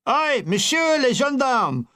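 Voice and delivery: high, slow, hammed-up call in schoolboy French; a mild mock yell, carefully spelled out; mock shout in bad French. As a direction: bellow it slowly at the gendarme in pidgin French. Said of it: "I shouted".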